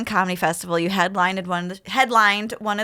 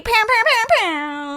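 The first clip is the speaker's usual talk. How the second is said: mock gunshots; 11 semitones higher than usual. Imitating gunshots.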